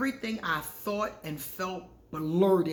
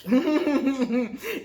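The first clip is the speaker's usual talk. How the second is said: a loud burst of laughter; bright laugh; 6 semitones higher, 7 dB louder than usual. Real, not acted. Vlogger laughing at her own story, loud laugh.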